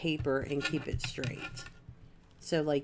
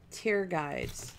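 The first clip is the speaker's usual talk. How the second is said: usual speaking voice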